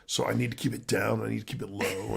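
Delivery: dropped low and soft; keeping voice low. Shown as a demonstration. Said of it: "so my voice goes higher".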